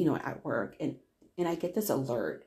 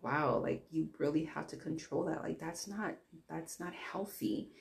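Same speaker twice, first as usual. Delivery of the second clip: much softer, understated retelling; quietly chide, trailing softer toward the end; gentle reproach; 8 dB quieter than usual. Retold or imitated. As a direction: imitate a concerned boyfriend, voice lowered in volume.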